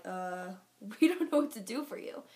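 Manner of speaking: stumped, hammed-up hesitation; baffled staff. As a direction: imitate clueless staff at a loss. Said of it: "and they're baffled".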